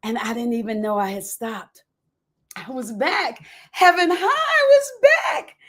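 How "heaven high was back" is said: much higher, slow, jubilant cry; joyful. Retold or imitated. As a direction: sing out the joy of being back. Joyful return of herself.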